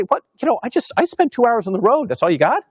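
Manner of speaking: high, incredulous mock complaint; hammed-up disbelief, pitched high; mock indignation. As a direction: playfully voice a parishioner who walked for hours and feels short-changed. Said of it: "they're gonna say".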